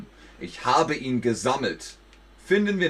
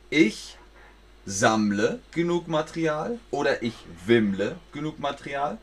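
usual speaking voice

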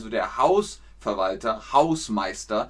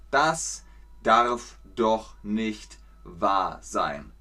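very slow, drawn-out repetition; slow model sentence; 3 semitones lower, much slower than usual. Shown as a demonstration. Teacher repeats slowly for learners to copy. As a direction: say it slowly for the class. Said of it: "Sehr gut".

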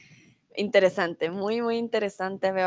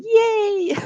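1 delighted cheer; 11 semitones higher, 4 dB louder than usual. Real, not acted. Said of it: "You were really close".

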